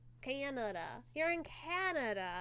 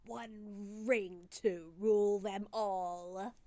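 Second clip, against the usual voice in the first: slow dramatic quote; 3 semitones lower, 4 dB louder, much slower than usual. Acted. Quoting the film's ring line.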